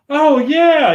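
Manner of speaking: high, loud fake "oh yeah"; a hammed-up sudden recollection; mock recognition. Brenner pretending to remember.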